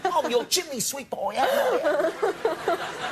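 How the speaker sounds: high, laughing hammed-up chimney sweep Cockney; mock Cockney accent. Host doing his own bad British accent, high and laughing Cockney. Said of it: "How I would do it".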